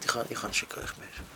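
hushed, voiceless mother's lament; mother whispering she can't cook anymore; whispered despair. Retold or imitated. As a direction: breathe it out as a broken whisper.

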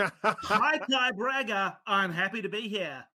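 high, pumped-up podcast host greeting; Ty's hype voice. Retold or imitated. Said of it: "say my hellos in a classic Thai hype voice".

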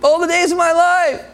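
high, lifted, yearning psalmist voice; lightly voiced David, sighing for the beauty of the Lord; David longing. David longs to behold God, high and yearning. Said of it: "The one who goes".

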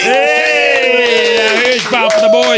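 high, loud whooping cheer. Real, not acted. Co-host hailing the boys in the band, high shouted cheer. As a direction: holler it like a crowd hyping the band.

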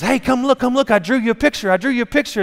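put-on high, eager kid voice, tumbling along; excited child. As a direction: play a kid proudly calling a parent over. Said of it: "they're so excited to come tell you".

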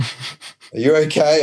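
mock-concerned wife, slowed right down; worried wife. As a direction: gently draw it out like a worried partner.

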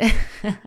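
short bright laugh; light laugh. Real, not acted. Amused by own guess.

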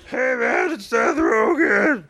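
high, slow shouted goofy voice; Seth Rogen impression. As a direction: shout it out in a silly drawn-out voice. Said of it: "Is that it".

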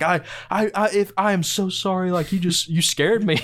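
high, fumbling, over-apologetic; flustered apology. Retold or imitated. Being led out, he apologizes to the actor, high fumbling voice. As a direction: stammer it out, eager to make amends. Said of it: "I'm like".

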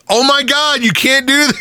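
high squeaky mock horror; mock shocked. Mocking prudes on South Park, high scandalized squeal.

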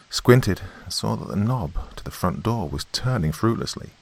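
usual speaking voice

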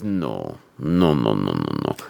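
very low put-on man's voice; deep, mock-grave refusal; antique shopkeeper. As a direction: imitate a grave old movie actor, far down in pitch. Mimicking older shopkeeper actor.

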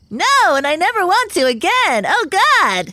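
high, appalled protest; disgusted. Acted. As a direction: recoil in mock horror. Disgusted by possum genitals.

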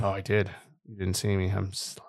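dropping to a hushed, sly aside; sneaky low-key murmur; sly whisper. Pretend. Sly joke after skipping items.

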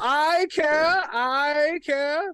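high, lofty aristocrat voice; posh character voice. Acted. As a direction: play a pompous noble. S0 enters in character voice.

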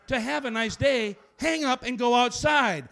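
loud, slow ending. Acted. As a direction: build to a big finish. Final lines pushed loud and slow.

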